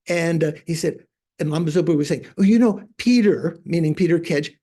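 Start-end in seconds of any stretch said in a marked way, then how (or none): 2.4-3.6 excited retelling. Retold or imitated. Retelling lama gushing about camera.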